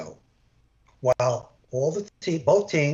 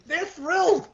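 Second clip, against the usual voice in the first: mock glee laugh. Mocking colleagues' glee.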